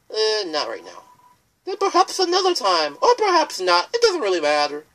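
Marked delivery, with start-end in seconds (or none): 1.6-4.8 whimsical ferryman. Acted. River Person answers refusal.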